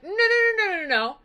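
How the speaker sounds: rapid string of protests, starting high; hasty denial. Retold or imitated. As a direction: brush off the idea hurriedly, pitch dropping. Odysseus hastily denies being a god.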